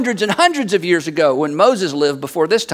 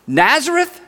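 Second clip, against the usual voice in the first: higher, rising one-word question; incredulous; 6 semitones higher, slower than usual. Acted. Nathanael objects to the Messiah's hometown, incredulous. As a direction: ask it as if you misheard. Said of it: "Nathanael is a little bit skeptical".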